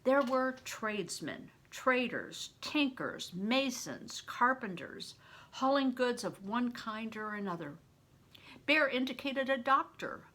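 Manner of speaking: usual speaking voice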